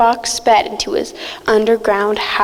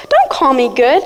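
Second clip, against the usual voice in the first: plain reading voice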